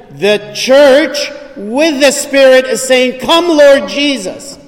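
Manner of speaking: high, loud and slow, calling it out; proclaiming scripture. Acted. Preacher proclaiming the Bride's cry to the congregation, loud and slow. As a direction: ring out the ending of Revelation. Preacher quotes Revelation's ending.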